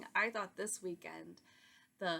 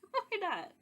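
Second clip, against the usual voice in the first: much higher, louder, playful exclamation; playful why not; 13 semitones higher, 6 dB louder than usual. Real, not acted. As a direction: toss it off gleefully. Playful self-justification.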